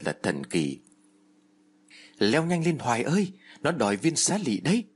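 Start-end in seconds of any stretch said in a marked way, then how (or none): 2.2-4.8 higher urgent shout; shouted warning. Acted. Medium warns the girl the demon is coming, urgent shout. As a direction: yell at her to climb faster. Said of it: "Cô Đồng Loan hét lên".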